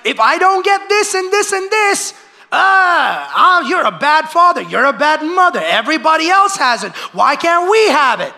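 put-on high, loud childish whine; whiny demanding kid. Preacher mimicking a child who demands things from parents, loud and high. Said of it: "They're like".

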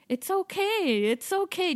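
high, soothing mom voice; reassuring mom. Retold or imitated. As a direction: coax a scared child along. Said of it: "I could hear the mom going".

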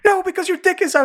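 very high, squeaky insistence; mock accusing. Retelling the accuser's comeback in the quarrel, mock accusing. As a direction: squeal it back in comic outrage. Acted back-and-forth quarrel from show.

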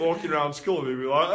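ordinary talk, nothing marked

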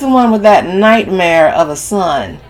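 fed-up stepmother; exasperated complaint. A narrator's reading. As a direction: lean on the grievance, loudly. Camille complains about stepson.

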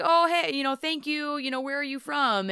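high, bright imitation of him; a man imitated making polite small talk, high and light; mock polite chat. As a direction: chirp politely like a stranger online. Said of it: "he's like".

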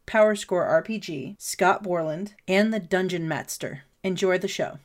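low, even announcer delivery; podcast intro read. Acted. Host welcomes listeners and thanks patrons, scripted intro read.

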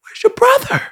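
very high, gentle question; God asking Cain. Retold or imitated. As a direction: ask knowing the answer already. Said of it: "he's like".

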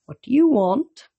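ordinary delivery

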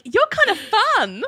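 high, slow put-on coo; mock flirtatious. [name] flirting with Richard, mock flirtatious. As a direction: imitate a charmed flirt, drawn out and high.

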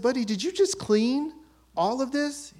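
can't believe he did it all; amazed. Retold or imitated. Dad amazed at clean room.

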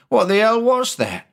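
sudden high alarmed cry; jolted by a shock under the hull; startled. Acted. Sailor feels sudden shock.

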